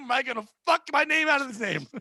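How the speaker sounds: ordinary delivery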